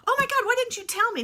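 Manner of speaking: high, loud mock-horrified exclamation; to her husband on seeing her face, a high shocked cry. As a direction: squeal it at your husband in dismay. Sees her ruined makeup in mirror.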